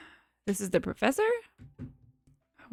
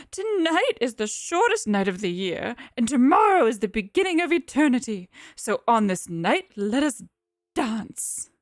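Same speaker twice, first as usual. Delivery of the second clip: solemn matriarch voice; 3 semitones higher, 4 dB louder than usual. Acted. Mother Rachel's speech to the assembly.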